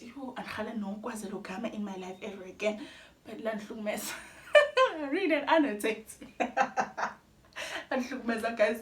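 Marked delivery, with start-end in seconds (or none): 4.6-8.8 laughing through the words. Real, not acted. Vlogger laughing at the exam she dreaded, laughing. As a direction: laugh it out, half pained.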